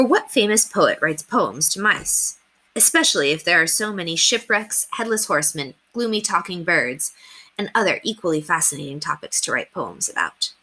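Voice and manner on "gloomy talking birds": ordinary delivery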